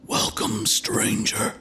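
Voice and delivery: breathy whisper from the dark; low whisper. Acted. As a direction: whisper it, unseen and close. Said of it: "The voice was low, almost a whisper".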